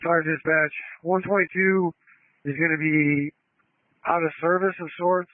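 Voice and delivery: tinny radio transmission; like a voice on a two-way radio; over a radio. Real, not acted. Field unit calling on radio.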